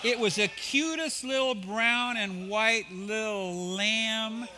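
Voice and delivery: high, slow, laughing over how cute it was; laughing tenderness. Real, not acted. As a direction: gush about the little lamb through a laugh. Describing the cute lamb.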